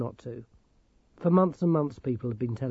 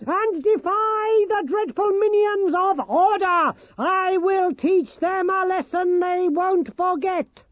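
high, slow, booming gnome proclamation; shouting Nicodemus; 7 dB louder, slower than usual. Acted. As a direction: declaim it like a tiny zealot. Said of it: "shouted Nicodemus".

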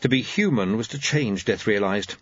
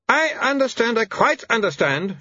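high-pitched haughty wife; 12 semitones higher than usual. A narrator's reading. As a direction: draw yourself up, high and offended. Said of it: "she said haughtily".

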